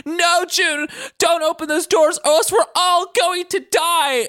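high panicked mock scream. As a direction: shriek the warning in mock panic. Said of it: "Doctor Sasaki's screaming".

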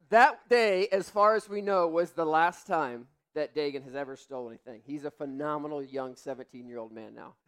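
ordinary talk

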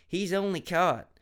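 ordinary delivery, nothing marked

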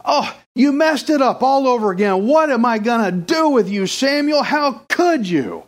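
high-pitched, exasperated telling-off; mock scolding. God imagined jumping on Samuel, exasperated mock scolding. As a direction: jump on him like a let-down parent. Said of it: "did not jump on Samuel".

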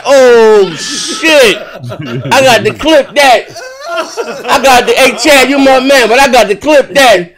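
laughing exclaim. Retold or imitated. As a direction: crack up while saying it. Streamer retells laughing reaction.

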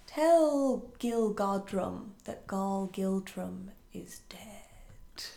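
slow, fading into a whisper; a spooky talking cat, slowing down to a whisper; eerie cat whisper. Retold or imitated. Cat speaks eerie message.

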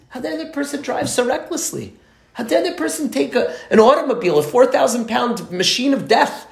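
inner indignation. Retold or imitated. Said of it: "All because I'm angry".

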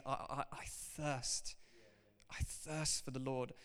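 I thirst said very softly and slowly, with hesitant repeats; a quiet, slow personal longing; soft plea. Shown as a demonstration. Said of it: "an attitude of saying".

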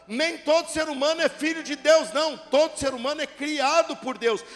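normal talking voice

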